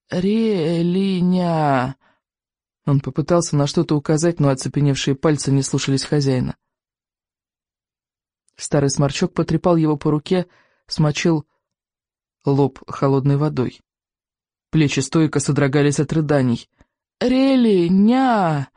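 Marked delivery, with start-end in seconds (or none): 0.1-1.9 slow faint plea; desperate babbling. A narrator's reading. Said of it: "в отчаянии лепетал Икинг". 17.2-18.7 high, slow desperate cry. A narrator's reading. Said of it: "снова вскрикнул несчастный Икинг".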